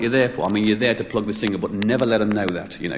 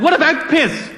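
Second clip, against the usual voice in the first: very high, indignant retort; hammed-up scandalised challenge; mock outrage; 4 dB louder than usual. DJ challenging the guest's denial, retold high-pitched outrage. DJ confronts guest over swearing.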